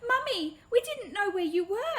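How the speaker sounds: high, loud, breathless relief; cling to her, overjoyed to find mum; relieved child. Acted.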